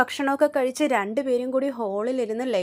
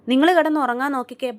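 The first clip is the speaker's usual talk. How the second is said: louder, sharp scolding order; 2 semitones higher, 10 dB louder than usual. Retold or imitated. Chandra scolding the girls for noise at night, loud. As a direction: tell them off to go to sleep. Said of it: "ചീത്ത പറഞ്ഞിട്ട്".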